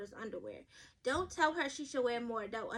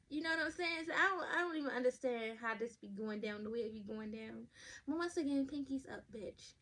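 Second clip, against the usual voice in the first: normal talking voice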